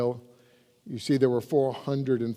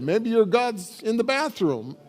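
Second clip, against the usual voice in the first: mocking; 7 semitones higher than usual. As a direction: poke fun at the false god. Preacher mocks Baal's silent god.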